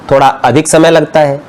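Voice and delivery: usual speaking voice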